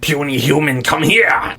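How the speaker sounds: high, loud monster shout; creature voice. Acted. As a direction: bellow at the puny humans.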